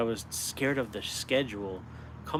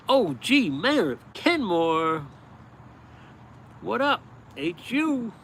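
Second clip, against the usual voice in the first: loud, slow, higher hype-man welcome; announcer call-out; 9 semitones higher, 5 dB louder, slower than usual. Real, not acted. Livestreamer shouting out a viewer, announcer style. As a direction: call the name out like an arena announcer. Greeting live chat viewers by name.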